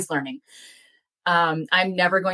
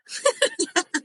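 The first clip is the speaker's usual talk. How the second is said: a bright burst of laughter, much higher than the speaking voice; high real laugh. Real, not acted. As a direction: crack up at your own joke about finding your real family. Laughs at own joke.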